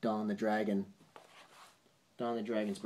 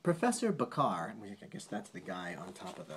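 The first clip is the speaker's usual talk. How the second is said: ordinary delivery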